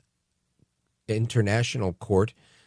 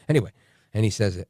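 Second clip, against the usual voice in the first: normal talking voice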